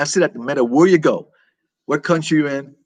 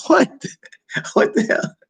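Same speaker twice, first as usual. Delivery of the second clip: much higher, laughing disbelief; laughing surprise; slower than usual. Real, not acted. Streamer shocked by a viewer's comment, laughing disbelief. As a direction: blurt out amazed through a laugh. Reacting to chat message, laughing.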